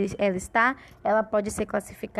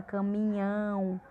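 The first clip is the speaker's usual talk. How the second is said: "caminhão" with a long open "ão"; stress on final syllable; 6 dB quieter, slower than usual. Shown as a demonstration. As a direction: open up and hold the end of "caminhão". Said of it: "a boca abre mais pra falar".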